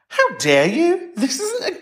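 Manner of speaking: slow, high, laughing "how dare you"; hammed-up offence at the caller; mock outrage.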